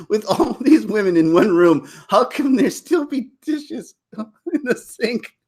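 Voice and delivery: high, slow, laughing through it; slow high amused retelling with laughter; laughing quote. Retold or imitated. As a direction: giggle out the line slowly. Quoting a sitcom line, amused.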